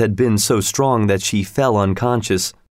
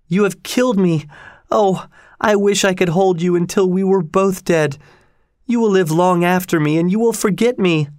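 high, lamenting voice throughout; anguished reproach; 9 semitones higher than usual. A narrator's reading. Dying woman to her lover, anguished and reproachful. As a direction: mourn and accuse, pitched well up.

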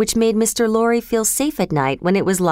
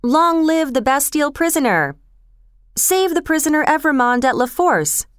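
higher, mildly raised crowd cheer; lightly voiced street crowd; crowd cries; 7 semitones higher than usual. A narrator's reading. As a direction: call it out like a cheering mob. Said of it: "loud cries reached Mister Lorry".

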